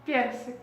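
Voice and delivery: "персик" as PYER-sik; Russian pronunciation. Shown as a demonstration. Teacher showing how peach is pronounced, Russian pronunciation. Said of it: "On prononce comme".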